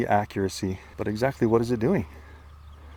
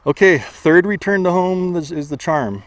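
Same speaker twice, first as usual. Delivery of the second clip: out of breath; 6 semitones higher, 4 dB louder than usual. Real, not acted. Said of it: "sorry I'm out of breath".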